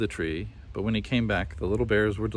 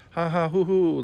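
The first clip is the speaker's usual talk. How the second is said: high hooting copycat laugh; lyre bird copying the laugh; 8 semitones higher, 4 dB louder than usual. A narrator's reading. Lyre bird imitating the kookaburra, hooting laugh. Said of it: "laughed the lyre bird".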